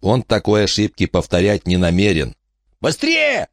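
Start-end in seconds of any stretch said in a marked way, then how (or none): 2.8-3.5 high, urgent yell. Acted. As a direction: scream at them to hurry. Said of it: "заорал он".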